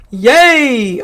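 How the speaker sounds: loud, drawn-out cheer; acted burst of excitement; excited cheer. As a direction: read it as an exclamation, loudly. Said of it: "Dom was kinda excited".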